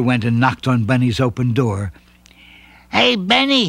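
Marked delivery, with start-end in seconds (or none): 2.9-3.7 much higher call; mild shout. A narrator's reading. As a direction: call through the door. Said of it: "he shouted".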